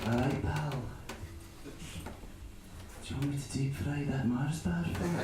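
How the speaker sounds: put-on soft, gentle Scottish accent; a hushed Scottish ASMR voice; quiet Scottish accent. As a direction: murmur it like a kindly Scot. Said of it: "the best thing is when it's quiet".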